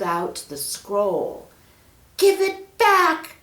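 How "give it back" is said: high, loud, slow desperate shout. Acted. Peter yelling at monkey who stole the scroll, loud shout. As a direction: yell it at the thief. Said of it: "shouted Peter".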